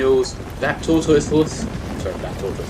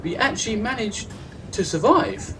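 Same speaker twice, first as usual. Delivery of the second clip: high-pitched retold astonishment; surprised; 12 semitones higher than usual. Gamer retelling how the team survived, surprised. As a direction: relive the team's amazed relief, high in pitch. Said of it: "we were so surprised".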